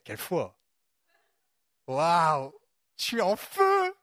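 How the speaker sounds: hammed-up thrill, pitch leaping; mock elation. Preacher imagining himself walking on water, mock excitement. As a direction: gush like you're on fire. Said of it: "si j'avais vécu ça, je me dirais".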